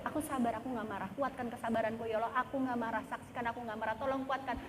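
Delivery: soft, private murmured plea; talking to God. Shown as a demonstration. Believer asks Allah to strengthen her patience, hushed prayer. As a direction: plead quietly with God for patience. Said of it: "Ngomong sama Allah".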